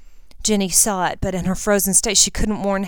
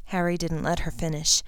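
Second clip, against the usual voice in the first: ordinary delivery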